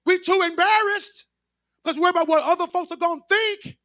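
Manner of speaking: high, loud pulpit shout; preaching at full force. Acted. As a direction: drive it home to the pews.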